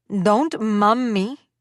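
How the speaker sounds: put-on slow, warning words in a thick Spanish accent. A narrator's reading. Mother warning her daughter in a thick Spanish accent. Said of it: "she warned in that thick accent".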